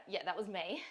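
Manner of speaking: normal talking voice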